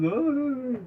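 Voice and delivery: a high, hammed-up crybaby wail; mock baby wail. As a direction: wail like a big baby, half joking. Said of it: "being a big old baby".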